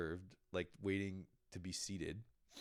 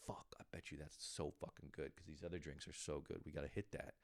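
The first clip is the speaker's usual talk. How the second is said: hushed, eager whisper to a friend; conspiratorial whisper; 5 semitones lower, 10 dB quieter than usual. Retold or imitated. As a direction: whisper it like a secret plan. Friends plotting to order drinks.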